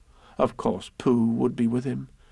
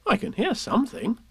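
lightly voiced higher child; a hint of a child's voice; Christopher Robin; 7 semitones higher than usual. A narrator's reading. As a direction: say it higher, as the boy. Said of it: "said Christopher Robin".

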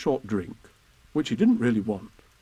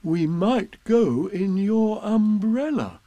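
higher, louder, slow repeat; said louder and slower; 8 semitones higher, 4 dB louder, much slower than usual. Acted. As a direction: say it again, loud and slow, so he hears. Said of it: "Huh? repeated louder".

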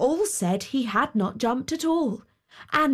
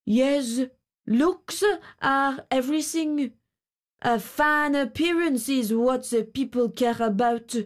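lightly voiced grasshopper, wistful; a hint of a musing grasshopper; 3 semitones higher than usual. A narrator's reading. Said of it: "and he said too".

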